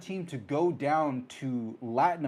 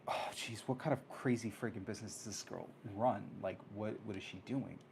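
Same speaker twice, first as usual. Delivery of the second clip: hushed aside; 3 semitones lower, 10 dB quieter than usual. Retold or imitated. Muttered aside about the girl.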